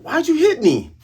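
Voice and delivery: high-pitched whine of a hit coward; high whiny complaint. Retold or imitated. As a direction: whimper like you got smacked.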